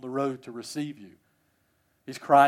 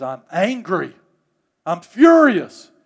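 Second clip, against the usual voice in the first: acted fury, building to a slow, loud FURIOUS; angry older brother; 4 semitones higher, 14 dB louder, slower than usual. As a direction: let the anger swell into a high shout. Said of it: "he voices his displeasure".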